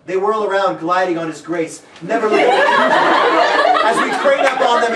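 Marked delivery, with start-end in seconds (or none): 2.0-4.9 mock romantic flourish; hammed-up narration. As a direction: stretch it out grandly. Narrator hams it up.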